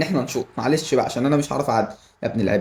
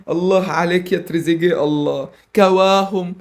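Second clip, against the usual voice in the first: usual speaking voice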